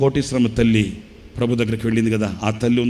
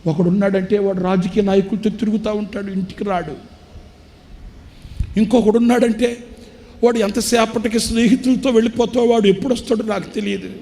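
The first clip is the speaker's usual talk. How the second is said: put-on crying old father; 10 semitones higher than usual. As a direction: lament it like a broken old man near tears. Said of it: "ఆ తండ్రి ఏడుస్తూ అంటాడు".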